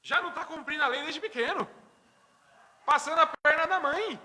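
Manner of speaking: half laughing at the little rascal. Real, not acted. Laughing about crafty toddler. (rindo alto)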